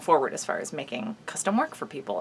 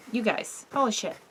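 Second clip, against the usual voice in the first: ordinary talk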